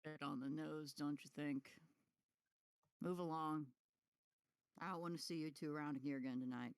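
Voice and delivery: a low, dismissive guard waving them off; guard shooing two suspects away, low and curt; gruff guard. Acted. GM voicing the guard.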